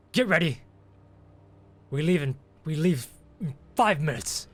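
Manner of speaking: high, loud gruff command to move out; captain barking orders before stomping off; curt order. Acted.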